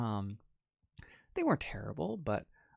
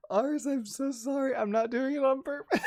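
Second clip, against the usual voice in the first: higher, louder apology broken by real giggles; laughing apology; 10 semitones higher, 5 dB louder than usual. Real, not acted. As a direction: apologise while you can't stop laughing.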